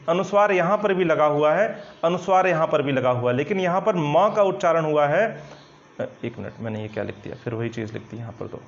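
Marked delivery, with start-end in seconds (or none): 6.0-8.6 muttered under the breath, much softer and lower; quiet aside. Real, not acted. Teacher spots a slip on the board, quiet aside. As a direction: mumble the correction to yourself. Teacher catches own writing mistake.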